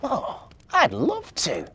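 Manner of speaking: high, slow and wondering elf voice; hopeful little elf. A narrator's reading. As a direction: ask it like a small elf who can't believe it. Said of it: "said Marzi".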